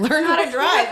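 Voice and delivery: shouted road-rage retort; angry yell. Pretend. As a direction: yell it at a bad driver. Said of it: "Be like".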